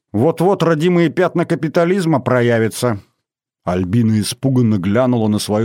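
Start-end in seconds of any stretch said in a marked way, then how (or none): 0.1-3.0 higher, mock-sinister prophecy; ominous. A narrator's reading. Rita warns Albina after the Coke, mock-ominous. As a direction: foretell it with playful menace. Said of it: "зловещим голосом сказала Рита".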